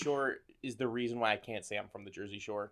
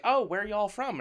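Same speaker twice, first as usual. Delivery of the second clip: put-on Southern accent; 8 semitones higher than usual. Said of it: "put on a fake Southern accent".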